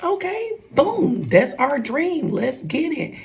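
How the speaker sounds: high-pitched, instant hype; eager agreement. Retold or imitated. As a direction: jump on board with a partner's dream. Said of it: "I'm like".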